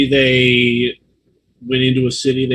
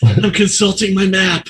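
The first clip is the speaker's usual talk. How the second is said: slow, higher put-on voice of the dead; mock corpse; 5 semitones higher than usual. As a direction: draw it out as a joking corpse. Player joins in as the corpse.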